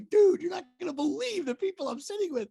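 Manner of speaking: high, loud, thrilled brag; acted excitement. Star-struck actor imagines calling his buddies, excited. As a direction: gush as if phoning your buddies.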